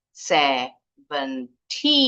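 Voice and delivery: "Seventy" very slowly as se, ven, ty; syllable-by-syllable stress. Shown as a demonstration. Teacher breaking seventy down, slow separate syllables. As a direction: draw out each syllable of "Seventy". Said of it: "intonasi yang tinggi di awal".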